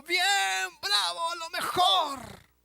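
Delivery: multitud aclamando. Retold or imitated. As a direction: hail him like a cheering mob. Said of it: "la multitud puede estar gritando". (la multitud que aclama con entusiasmo)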